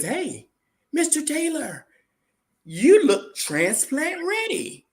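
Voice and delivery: high, drawn-out good news from a doctor; imitating a doctor's high, slow delight; delighted announcement. Doctor greets him with good news.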